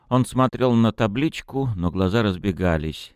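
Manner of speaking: ordinary delivery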